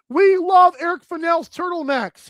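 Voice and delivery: normal talking voice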